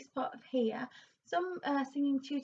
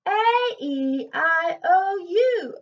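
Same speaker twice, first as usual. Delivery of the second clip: slow, louder vowels between rounded and forward; balanced resonance; 7 semitones higher, 12 dB louder, much slower than usual. Shown as a demonstration. Coach showing the middle-ground vowel sound. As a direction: blend rounded and mask sounds. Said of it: "something kind of between the two".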